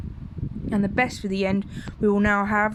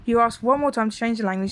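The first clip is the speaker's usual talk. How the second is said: ordinary delivery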